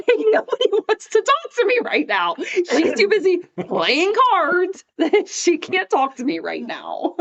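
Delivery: high, loud laughing whine; drawn-out mock self-pity, laughing; comic complaint. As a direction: wail it playfully, breaking into laughter. Comic complaint about being brushed off.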